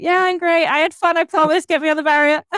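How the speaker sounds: high, loud, strained cheer; forced cheer. Retold or imitated. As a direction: fake being fine. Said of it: "I was like".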